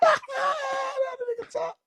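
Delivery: squealing high through laughter, slow; can barely get it out for laughing; laughing hard. Real, not acted. Cracking up over dad.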